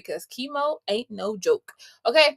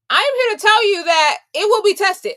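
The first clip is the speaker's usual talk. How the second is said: higher and louder, preaching it; emphatic warning; 9 semitones higher, 7 dB louder than usual. Real, not acted. Coach promising viewers their intention will be tested, loud and high. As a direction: declare it like a coach firing up her team.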